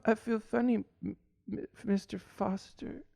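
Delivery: fading to a near whisper, slowing; a child losing consciousness; fading kid voice. Acted.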